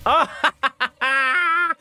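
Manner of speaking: high startled yell of frustration; frustrated yell. Real, not acted. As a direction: yelp in frustration. Dies in game, yells.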